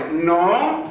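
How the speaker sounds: ordinary delivery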